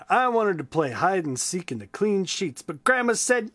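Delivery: lively, high storybook voice; reading to children. A narrator's reading. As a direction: read it big and bright for kids. Reads a children's book aloud.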